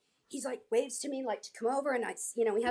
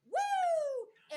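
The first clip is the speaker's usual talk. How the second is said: high imitated whoop; crowd cheer. Crowd cheering the surprise guest, high mock whoop. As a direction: cheer like a crowd, at normal volume. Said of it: "the crowd goes wild".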